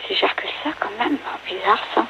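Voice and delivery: hushed whisper to herself. Real, not acted. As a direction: murmur it away from the phone. Aside to daughter during call.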